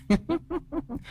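high eerie cackle, suddenly soft; cackling laugh. A narrator's reading. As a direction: cackle like a gleeful demon. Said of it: "nhe răng cười khanh khách". (tiếng cười khanh khách ma quái)

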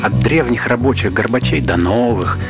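ordinary talk, nothing marked

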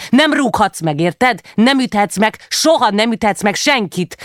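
high, forceful parental scolding; angry reprimand. A narrator's reading. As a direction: lay down the law to a child. Mother reprimands child for hitting.

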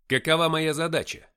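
loud, high, pressing question; insistent demand. A narrator's reading. Narrator looking Deimos in the eye, insistent demand. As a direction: demand it forcefully.

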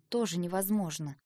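usual speaking voice

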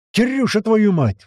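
high, loud exasperated yell; enraged roar. A narrator's reading. Man turning on the parrot, roaring angrily. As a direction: roar at the misbehaving bird. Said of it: "взревел мужик".

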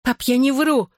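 high-pitched cry, restrained in volume. A narrator's reading. Daughter under father's heavy hand, crying out desperately. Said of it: "выкрикнула я".

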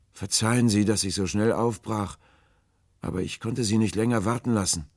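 high, loud call from a boat; apologetic stranger. A narrator's reading. Stranger returns to thank the sailors, calling apologetically. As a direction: call the apology across the water.

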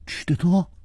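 high-pitched mock alarm; feigned horror. A narrator's reading. Chu playing shocked about the drug, feigned horror. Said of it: "楚留香故意骇然说".

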